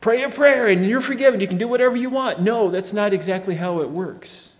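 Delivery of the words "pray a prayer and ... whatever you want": higher, louder, flippant mock of easy believism; mocking the opponents' easy-believism slogan, higher and louder; mocking opponents. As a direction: toss it off like a careless scoffer. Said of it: "opponents who thought that he was teaching easy believism".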